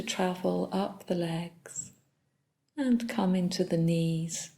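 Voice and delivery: soft, unhurried body-scan guidance with long pauses; calm meditation leader, phrase by phrase; guided meditation. Real, not acted. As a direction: guide them gently through the body, leaving long silences.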